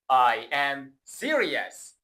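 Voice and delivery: "I am serious" said very slowly, each word clear; slow model sentence. Shown as a demonstration. Teacher modelling "I am serious" slowly for the class to repeat. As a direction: spell it out for the learner to copy. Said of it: "با من تکرار کن".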